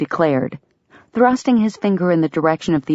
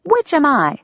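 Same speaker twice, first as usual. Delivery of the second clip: higher, pointed question; challenging; 10 semitones higher than usual. A narrator's reading. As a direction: dare him to answer. Delia challenging brother.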